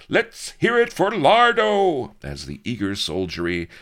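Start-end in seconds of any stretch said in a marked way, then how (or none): 0.1-2.1 very high, loud soldier's cheer; high, loud rowdy cheer; enthusiastic cry. Acted. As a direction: cheer it out eagerly. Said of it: "enthusiastic cries".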